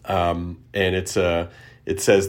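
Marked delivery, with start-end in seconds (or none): none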